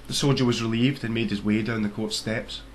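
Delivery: plain reading voice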